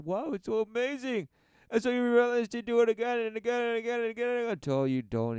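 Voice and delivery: high, fast, giddy rush; mock ecstatic. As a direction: gush like a dazzled fan. Mocking ecstatic worshipper.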